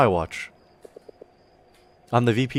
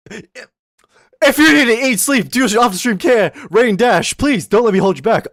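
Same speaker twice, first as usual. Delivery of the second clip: higher, louder and slowed right down; emphatic loud repeat; 12 semitones higher, 10 dB louder, slower than usual. Shown as a demonstration. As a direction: drag it out big for the whole chat. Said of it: "repeats it slower and louder".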